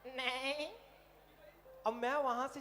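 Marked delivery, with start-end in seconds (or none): 0.0-0.7 goat voice. Retold or imitated. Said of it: "कहती".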